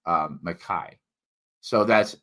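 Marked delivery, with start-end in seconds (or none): 0.4-1.0 Mackay said "muh-KAI", ending like "sky"; demonstrated pronunciation. Shown as a demonstration. Teaching listeners the trick, Mackay read as if spelled with A-I. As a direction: say Mackay with a long I at the end. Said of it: "you would say".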